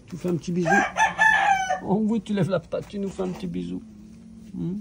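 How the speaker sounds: high coaxing voice for a puppy; talking to a dog. Real, not acted. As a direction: coax the puppy sweetly. Asks the puppy for a kiss.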